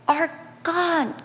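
ordinary talk

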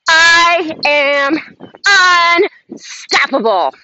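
building affirmation. Shown as a demonstration. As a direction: lead the group through big slow affirmations. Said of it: "Repeat after me, guys".

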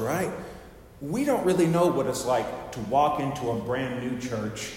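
ordinary delivery

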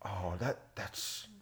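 soft, slow, hushed radio interviewer; Terry Gross impression. Mocking a radio host to his brother, slow hushed murmur. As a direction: drop to a murmur and savor it. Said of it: "Terry Gross".

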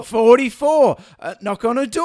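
bingo caller. Acted. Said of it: "So for".

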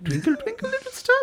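can't believe the request; incredulous composer. Retold or imitated. Composer asked to play a nursery rhyme, incredulous.

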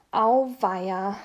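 usual speaking voice

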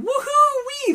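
high, loud gleeful whoop; childlike whoop. Acted. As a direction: cheer like a kid swinging on ropes.

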